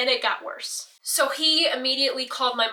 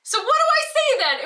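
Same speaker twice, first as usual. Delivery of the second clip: much higher, louder desperate plea; panicked pleading; 6 dB louder than usual. Retold or imitated. Daughter to scolding parents, high louder panicked plea. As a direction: cry out helplessly at the scolding. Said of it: "I'm like".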